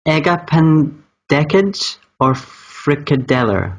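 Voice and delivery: Danish words said very slowly; really slow. Shown as a demonstration. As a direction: sound out the foreign words at half speed. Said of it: "I'm gonna say it really slow".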